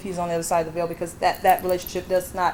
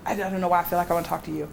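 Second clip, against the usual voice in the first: normal talking voice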